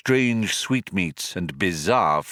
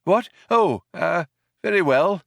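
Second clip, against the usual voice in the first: put-on old man, higher and mildly flustered; slightly taken aback; 9 semitones higher, 4 dB louder than usual. A narrator's reading. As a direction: give way, a little thrown. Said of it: "slightly taken aback".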